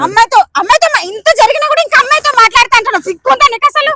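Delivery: loud, fast, high outrage; angry scolding. Acted. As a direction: tear into him, no shame. Wife berates cheating husband. (భర్తను కోపంగా, వేగంగా తిట్టడం)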